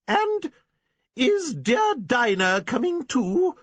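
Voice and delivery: a spinster's sharp, high dig; shrilly. Acted. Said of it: "asked Miss Prentice shrilly".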